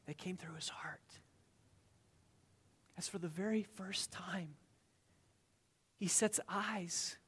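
soft whisper with long pauses; hushed wonder. Acted. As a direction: breathe it like a secret in awe. Tender hushed storytelling.

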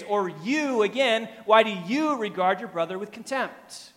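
"you" stretched high and loud; emphatic stress. Shown as a demonstration. Preacher showing the second phrase's emphasis, stress on "you". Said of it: "It's emphatic".

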